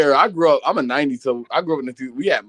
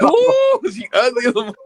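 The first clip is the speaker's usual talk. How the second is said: shrill ooh; 7 semitones higher, slower than usual. Retold or imitated. Mocking reaction, pitch jump.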